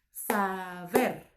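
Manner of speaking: "Saber" in two beats, sa - ber; división silábica correcta. Shown as a demonstration. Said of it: "termina en R". ("Saber" separado en sa - ber)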